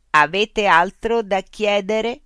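high, loud, slow loudspeaker machine. Acted. Loudspeaker robot voice addressing Mitch. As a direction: speak as a polite, mechanical information unit.

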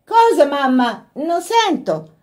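high, drawn-out call. A narrator's reading. Laura calling to her mother up the stairs, loudly. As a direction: call to someone on another floor.

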